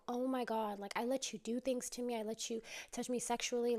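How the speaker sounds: usual speaking voice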